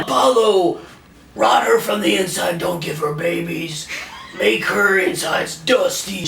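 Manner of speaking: lightly hammed-up curse to the sun; a hint of an old king cursing, slowing at the end; mock curse. Drunk teller playing Lear cursing his daughter, lightly hammed. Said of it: "he was like".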